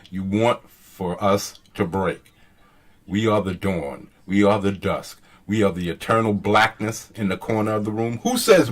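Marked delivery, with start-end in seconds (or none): 0.1-5.2 low, soft dramatic recital; split-second pauses. Shown as a demonstration. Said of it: "a split second". 5.5-8.2 low, soft script reading; dramatic recital. Shown as a demonstration.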